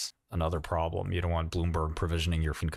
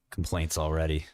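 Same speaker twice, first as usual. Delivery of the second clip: ordinary talk, nothing marked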